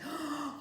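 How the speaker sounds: high mock gasp of delight; imitated child's gasp. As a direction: gasp like a thrilled little pupil. Imitating grateful pupil.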